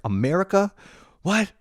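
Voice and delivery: high-pitched, baffled disbelief; incredulous echo. Retold or imitated. New student baffled by his American roommate, incredulous. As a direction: repeat it back as if unheard of.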